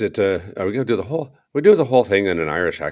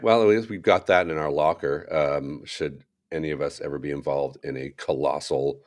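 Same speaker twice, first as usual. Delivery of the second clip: ordinary talk, nothing marked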